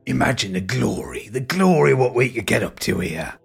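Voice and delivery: a hint of a menacing London tempter; slow, savouring Cockney hard man; Billy Butcher voice. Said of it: "he says in his Billy Butcher voice".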